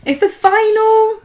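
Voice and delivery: high, loud, stretched-out objection; amused protest. Real, not acted. Partner justifying the long match, amused high protest. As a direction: protest with laughter close behind, drawing it out. Laughing protest at his complaint.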